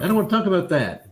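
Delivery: high, quick protest; hinted upset listener. Retold or imitated. Voicing the poor listener.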